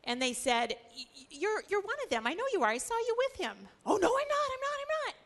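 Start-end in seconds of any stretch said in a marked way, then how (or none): 1.0-3.7 higher, stammering accusation; a higher pitched nosy accuser; accusing bystander. Acted. Accusers by the charcoal fire. 3.8-5.2 much higher, frantic denial; high, loud, flustered no; panicked denial. Acted.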